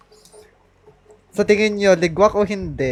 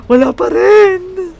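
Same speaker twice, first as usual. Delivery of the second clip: much higher, louder and slower cry of despair; exasperated yell of disbelief; frustrated shout; 13 semitones higher, 9 dB louder, slower than usual. Real, not acted. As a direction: wail it at the screen. Frustrated at failed pull.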